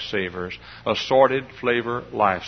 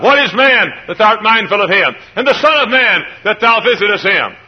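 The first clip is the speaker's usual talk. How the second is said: high, loud pulpit recitation; preaching psalm; 11 semitones higher, 10 dB louder than usual. Acted. Preacher proclaims the psalm to his congregation at full force. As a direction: proclaim it to the rafters.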